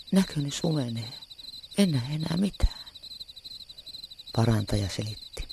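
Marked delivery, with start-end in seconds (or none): 0.1-2.9 moaning. A narrator's reading. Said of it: "Leijona vaikeroi".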